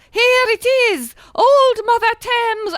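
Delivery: usual speaking voice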